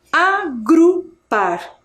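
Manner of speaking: "agrupar" very slowly in separate pieces, a-gru-par; syllable by syllable. Shown as a demonstration. Teacher shows gru in mid-word, slow and syllable by syllable. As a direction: break "agrupar" into drawn-out syllables so GRU stands out. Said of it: "com a sílaba gru".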